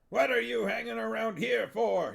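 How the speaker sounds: high, loud impatient yell. Acted. Huel yelling at the witches, loud high. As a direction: shoo them out with a yell. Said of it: "he yelled".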